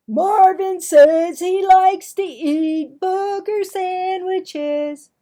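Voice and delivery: very high, loud, slow jeer; bully taunting. Acted. Clarence tells everyone Marvin's food, very high loud jeering. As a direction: sing it out to the whole lunchroom.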